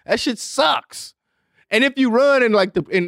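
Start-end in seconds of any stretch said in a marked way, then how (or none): 0.0-1.1 slow high complaint. Real, not acted.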